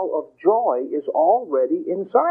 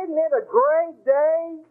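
high, slow, syrupy cheer; gushing cheery bore; 11 semitones higher, slower than usual. Retold or imitated. A lecturer mocks the unbearable happy person, gushing. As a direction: gush like someone nobody can stand.

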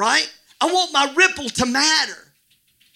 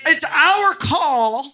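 slow, raised, stretched-out proclamation; yelling preacher; 4 semitones higher, slower than usual. Real, not acted. Preacher declaring the congregation's life call, yelling slowly. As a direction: proclaim it high and drawn out. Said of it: "I don't know why I'm yelling at you".

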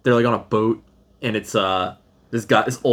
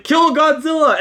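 much higher, loud battle cry; army shout; 14 semitones higher, 4 dB louder than usual. Retold or imitated. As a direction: yell it like soldiers charging. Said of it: "the Japanese army's like".

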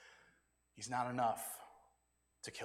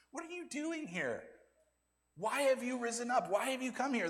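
higher-pitched indignant accusing voice; scolding big brother; 8 semitones higher than usual. Retold or imitated. Eliab scolds his little brother David, higher and indignant. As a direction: play the annoyed older brother demanding answers. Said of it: "Eliab comes in and says".

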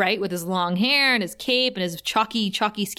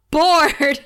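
loud, higher mock outburst; defiant punchline; 6 semitones higher, 7 dB louder than usual. Buffy tells Dracula what she feels, loud mock defiance. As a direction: blurt it out louder, mock defiant.

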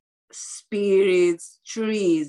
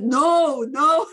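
insistent retold protest; 6 semitones higher, 6 dB louder than usual. Retold argument with her husband.

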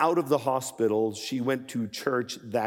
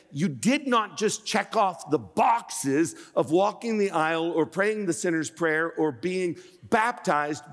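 higher-pitched, driving sermon delivery; impassioned preaching; 7 semitones higher than usual. Real, not acted. Preacher urging the congregation to know they are alive, impassioned. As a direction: preach it with mounting urgency, punching key words.

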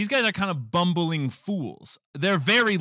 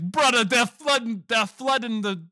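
mock alarm; 7 semitones higher than usual. Retelling Luigi's alarm.

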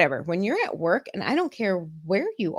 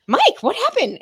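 surprised; 13 semitones higher, 6 dB louder than usual. Retold or imitated. Shocked boss swears.

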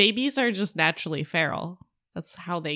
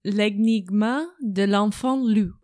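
loud attempt at a French accent; shitty French accent; 5 dB louder than usual. Shown as a demonstration. Co-host retries the book title for host, loud amateur French accent. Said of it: "my shitty French accent".

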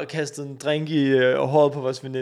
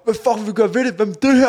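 higher, louder mock yell; retold shout; 8 semitones higher, 9 dB louder than usual. A guy at the back of the group yelling back, mock shout. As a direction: throw the challenge back across the crowd. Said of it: "råber".

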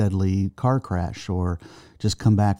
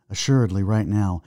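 usual speaking voice

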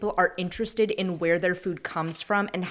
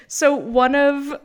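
ordinary talk, nothing marked

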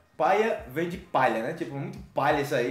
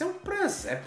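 mocking remark; 13 semitones higher, 5 dB quieter, slower than usual. Mocking oddly dressed person.

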